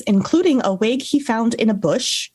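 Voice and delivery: usual speaking voice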